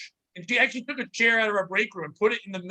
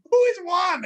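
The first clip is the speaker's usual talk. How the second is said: mock yell; 9 semitones higher, 5 dB louder than usual. Repeating question into walkie.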